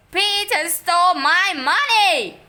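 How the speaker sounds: high, loud accusing scream. A narrator's reading. Boy screaming an accusation to Mom. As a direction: scream the blame at his brother. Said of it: "screamed Henry".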